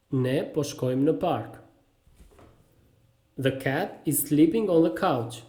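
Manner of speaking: normal talking voice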